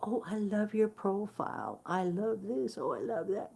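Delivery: mock sweet troll. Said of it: "when somebody says to me".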